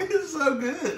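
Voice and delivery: laughing through the words; giggling while talking. Real, not acted. Tasting spicy noodles, laughing.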